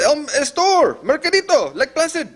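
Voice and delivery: put-on stammered start, very high Spanish-accented plea; imitated panicking caller. Panicked caller answers the dispatcher, imitated panic. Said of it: "the caller panics".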